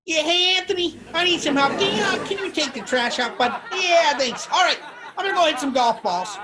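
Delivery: very high, loud, nasal dad impression; high nasally voice. Dad Bob asking his son to take the trash out, high and nasally. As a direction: blare it out high through the nose, loud and proud. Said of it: "He talks in a real high, nasally voice".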